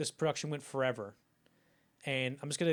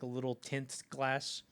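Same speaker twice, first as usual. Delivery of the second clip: normal talking voice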